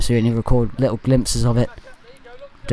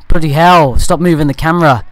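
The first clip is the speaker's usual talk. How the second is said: sudden higher, louder cry at the cameraman; exasperated outburst; 4 semitones higher, 6 dB louder than usual. Real, not acted. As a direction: snap out real irritation, pitch jumping up. Annoyed at the cameraman moving.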